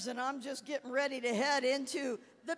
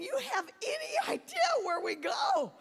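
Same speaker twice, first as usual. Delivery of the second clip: frantic cry; 10 semitones higher than usual. Retold or imitated. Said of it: "I said to Gene".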